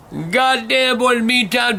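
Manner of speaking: ordinary delivery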